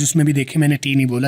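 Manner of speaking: ordinary talk, nothing marked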